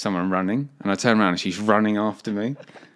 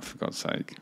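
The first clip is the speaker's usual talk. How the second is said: retold under-the-breath grumble, half laughing; soft, voiceless mock despair; muttered exasperation; 10 dB quieter, slower than usual. Pupil dreading being caught by the French teacher, muttered exasperation.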